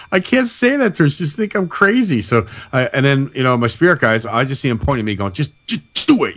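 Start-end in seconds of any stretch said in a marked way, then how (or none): 0.1-2.2 high, worried muttering to himself; voicing his own panic, pitched way up; panicked inner voice. Recalling his doubts about telling coworker Nikki, panicked and high. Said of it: "So I'm like". 5.3-6.4 mock frustrated urging. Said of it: "they're getting all frustrated with me".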